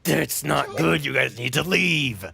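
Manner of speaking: pressing, loud captain's warning; urgent warning. Acted. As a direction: urge the crew to get out. Captain urges the party to leave.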